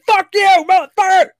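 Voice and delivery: high, loud hostile outburst; mock angry yell. Answering a buddy's friendly greeting, mock furious yell. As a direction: explode at a friendly hello.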